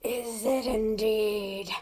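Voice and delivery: slow, drawn-out character voice; measured, theatrical musing; suave character voice. Acted. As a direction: draw the words out with intrigue. Attempts the character's remembered line.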